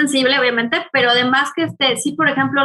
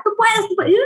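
encouraging; 9 semitones higher than usual. Retold or imitated. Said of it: "A Sol la ponía a estudiar así".

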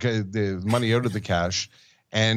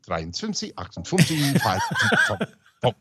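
counting in German; slower than usual. Retold or imitated. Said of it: "he was so used to counting in German".

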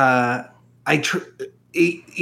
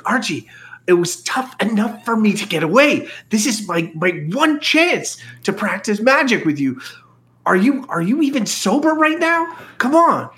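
pleading whisper; 6 semitones higher than usual. Acted.

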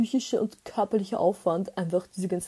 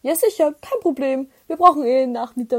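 breezy landlord; 4 semitones higher, 6 dB louder than usual. Retold or imitated. Imitating his breezy reassurance.

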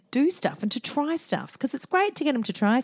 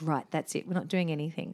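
firm, low; 5 semitones lower, 7 dB quieter than usual. Retold or imitated. Firm decision.

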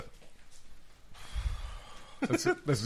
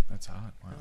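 deadpan; 6 semitones lower, 9 dB quieter than usual. Retold or imitated. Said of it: "And you're like".

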